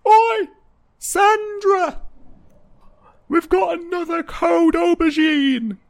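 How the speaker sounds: high, loud holler down a pipe; yell. Acted. Guard calls Sandra through the pipe, yelling. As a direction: bellow to summon someone far off. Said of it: "puts his mouth near it and yells".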